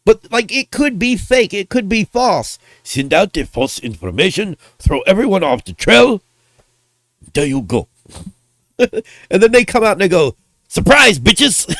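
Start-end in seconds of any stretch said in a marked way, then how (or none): 2.8-7.9 clumsy mock Japanese accent; Japanese accent impression. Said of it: "I do impressions". 10.7-11.7 louder, higher start, dropping after; spring a surprise on everyone, playfully; mock gleeful reveal. Acted gleeful reveal by the company.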